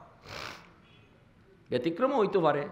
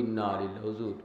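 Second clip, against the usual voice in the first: lightly voiced tea seller; 5 semitones lower, 5 dB quieter than usual. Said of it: "কই".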